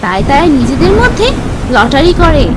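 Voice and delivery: plain reading voice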